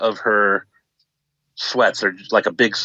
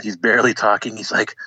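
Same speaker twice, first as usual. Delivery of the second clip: ordinary talk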